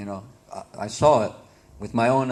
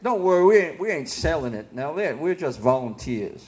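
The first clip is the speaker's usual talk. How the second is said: easygoing put-on Kentucky country accent; put-on Southern accent; 3 semitones higher than usual. Book distributor reassuring a local, put-on Southern accent. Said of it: "I had to talk like that".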